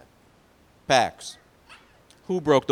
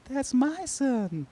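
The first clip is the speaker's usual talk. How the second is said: high, drawn-out doting mother; high-pitched proud mom voice; 12 semitones higher, much slower than usual. Acted. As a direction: gush slowly over your boy in a high voice. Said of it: "You know what Mom said?".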